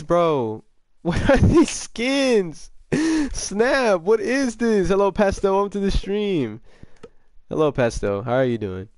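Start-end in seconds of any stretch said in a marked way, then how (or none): 1.0-4.9 high, drawn-out incredulous question; laughing disbelief. Real, not acted. Streamer seeing players' ridiculous skins, incredulous. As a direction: stretch it out in amused horror.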